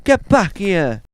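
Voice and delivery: high, urgent shouted commands; shouting. Acted. Policeman chasing a bank robber, urgent high shout. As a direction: yell it after a fleeing crook. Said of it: "Why are you shouting?".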